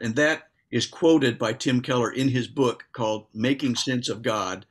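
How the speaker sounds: ordinary delivery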